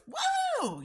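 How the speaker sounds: high loud whoop; cheering whoop. Retold or imitated. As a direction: cheer like a crowd. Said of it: "people just start clapping".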